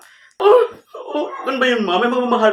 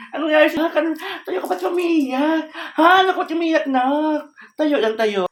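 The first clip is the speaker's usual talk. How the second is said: raised, urgent worried questioning; alarmed mother; 6 semitones higher than usual. Acted. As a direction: rush in frantic over a crying child. Mother finds daughter crying on floor.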